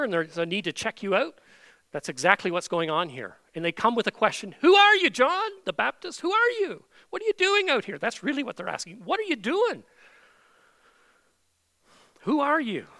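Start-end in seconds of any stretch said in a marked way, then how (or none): 4.6-8.0 high, loud demanding questions; challenging interrogators. Acted. Delegation questioning John, acted. 9.0-12.9 challenging interrogators. Acted. Acted repeat of the questioners.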